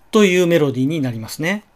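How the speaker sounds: ordinary talk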